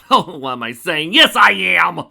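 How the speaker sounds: loud, rising villain glee; mad gloating. Acted. Conquest gloating to the mare through her own mouth, mad loud glee. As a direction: crow it with mad delight.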